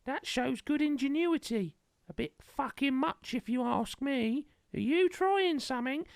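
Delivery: high put-on voice mocking the villain. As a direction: parody the villain's menacing lines. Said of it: "she's got a knife to her going".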